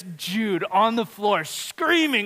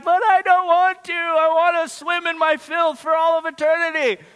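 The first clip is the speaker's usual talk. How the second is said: put-on whiny child; 11 semitones higher, 7 dB louder than usual. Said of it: "you telling your kid".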